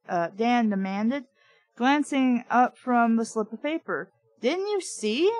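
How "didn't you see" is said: higher, a hint of a shriek; light shriek. A narrator's reading. Trina to Dan, lightly shrieked. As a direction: pitch it up, alarmed. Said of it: "I shrieked".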